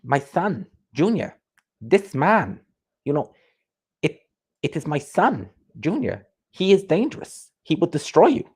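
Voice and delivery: high-pitched, halting mock-grand boxing dad; Eubank Senior impression. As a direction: imitate a proud father in measured, separate phrases. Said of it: "If I was to do a Eubank Senior impression".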